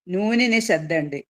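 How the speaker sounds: ordinary talk, nothing marked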